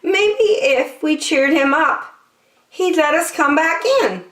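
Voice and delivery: zebra voice. Acted. Zebra stops the friends, in a high voice. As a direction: pipe up with an idea, pitched high.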